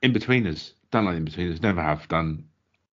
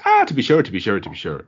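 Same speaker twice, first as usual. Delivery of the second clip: stock Irish accent; 2 semitones higher, 5 dB louder than usual. Shown as a demonstration. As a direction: do the cliché stage Irish, dropping off after the opening "Ah". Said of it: "the only Irish accent everyone can do is".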